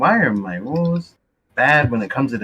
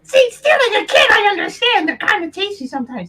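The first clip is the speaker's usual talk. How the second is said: much higher, squeaky and gleeful; high goblin voice; 4 dB louder than usual. Acted. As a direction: chirp it as if eating kids is normal. Goblin keeps ranting in voice.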